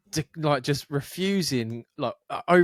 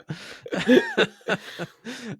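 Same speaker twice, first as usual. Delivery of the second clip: real burst of laughter; 9 semitones higher than usual. Real, not acted. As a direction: let the laugh spill out. Laughing after retort.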